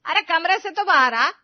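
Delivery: high, loud, impatient summons; scolding shout. Acted. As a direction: yell at her to come out. सास बहू पर चिल्लाती है. (चिल्लाकर बाहर बुलाना)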